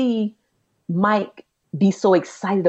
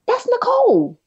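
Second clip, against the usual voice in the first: much higher and louder, a pleased exclamation; surprised recognition; 4 dB louder than usual. Retold or imitated. Aunt peeking out the window at a car, surprised. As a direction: call out the name as if it just clicked. Spotting a friend's car outside.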